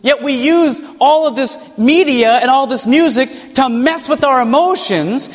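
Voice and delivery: ordinary talk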